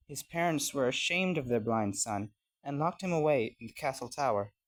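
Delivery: ordinary delivery, nothing marked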